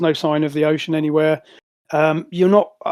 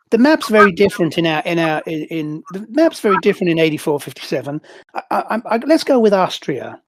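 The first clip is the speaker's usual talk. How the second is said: Benji's voice; 5 semitones higher than usual. Acted. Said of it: "GM voicing Benji answering the player".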